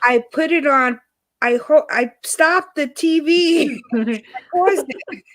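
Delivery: exasperated retold explanation. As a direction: explain it again to a baffled husband, half laughing. Retelling exasperated explanation to ex-husband.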